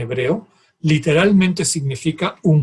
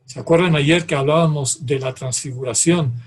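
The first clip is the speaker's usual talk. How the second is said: normal talking voice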